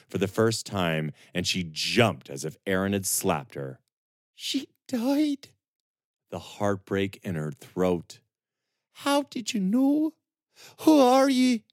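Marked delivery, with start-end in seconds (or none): 4.3-5.5 put-on high, far young woman's voice, broken by grief; Serana, grieving. Serana speaks, grieving captive. 8.9-11.6 put-on high, far young woman's voice, broken by grief; Serana, grieving. Serana questions stranger.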